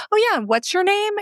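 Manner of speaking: lifted voice for a retold introduction; imitated eager husband. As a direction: jump in high, playing the helpful husband. Husband acted jumping in with question.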